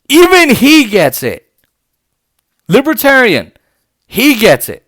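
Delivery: high, loud, insistent emphasis; emphatic exasperation. Real, not acted. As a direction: hammer home that even he gets it. Ranting about Rand Paul agreeing.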